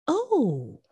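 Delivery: lightly imitated Southern belle greeting; high, too-nice Southern hello; Ashley. Friend opens door on silly eyebrows, imitated polite Southern greeting. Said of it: "in her deep Southern accent".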